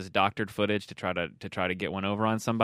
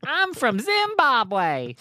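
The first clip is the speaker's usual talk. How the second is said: put-on high, very slow, botched Zimbabwean accent; hammed-up Kentucky twang posing as Zimbabwean; bad Zimbabwe accent; 13 semitones higher, 4 dB louder, much slower than usual. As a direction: draw out a badly faked Zimbabwe accent. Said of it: "That's not how they talk from Zimbabwe".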